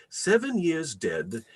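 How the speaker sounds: usual speaking voice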